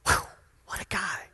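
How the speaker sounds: breathy hushed whisper of awe; awed whisper. Real, not acted. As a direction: whisper it to yourself, amazed. Awed aside after reading scripture.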